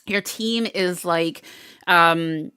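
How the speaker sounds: ordinary talk, nothing marked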